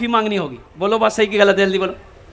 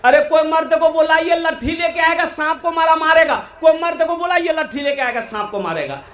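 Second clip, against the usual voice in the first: higher, frantic cry for help; women yelling; 7 semitones higher than usual. Retold or imitated. Women frightened by a snake calling for a man, high mock yell. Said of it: "तो औरतें चिल्लाने लगी".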